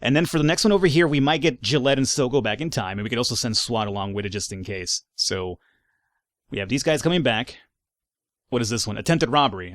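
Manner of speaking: usual speaking voice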